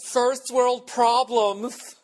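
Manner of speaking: very high, slow, sneering sing-song; mocking retort. Mocking a listener's snide comeback, slow high sneer. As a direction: drag it out snidely like a smug critic. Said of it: "before somebody went".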